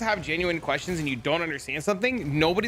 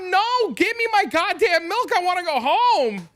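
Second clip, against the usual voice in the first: very high, loud hammed-up outburst; retold furious yell; 13 semitones higher, 5 dB louder than usual. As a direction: act out losing it at the cashier. Acts out outburst at the cashier.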